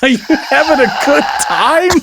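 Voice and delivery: very high, laughing squeal; high voice breaking into laughter; laughing mockery. Real, not acted. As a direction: squeak it out while cracking up. Cracking up at the clip.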